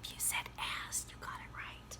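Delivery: soft unvoiced whisper to the class. Shown as a demonstration. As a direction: whisper it like a secret. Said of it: "I want you just to whisper it right now".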